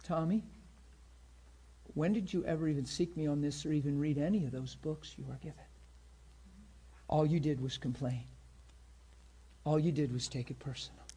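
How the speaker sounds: lightly voiced God gently reproving a man; the Lord speaking. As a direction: correct him quietly, leaving space after each sentence. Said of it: "the Lord spoke to him and said".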